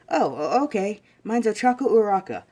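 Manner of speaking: put-on girlish eagerness; chirpy schoolgirl. Ochako introducing herself to Izuku, chirpy.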